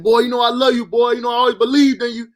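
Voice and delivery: cousin's encouragement. Retold or imitated. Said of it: "He's like".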